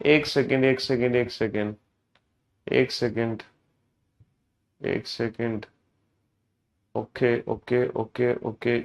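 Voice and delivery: low, soft murmur to oneself; distracted muttering. Real, not acted. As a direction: stall quietly while checking something. Caught out, checking the chapter mid-class. (धीमे, दबे स्वर में बुदबुदाते हुए)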